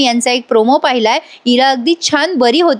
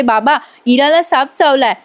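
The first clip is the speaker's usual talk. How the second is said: ordinary talk, nothing marked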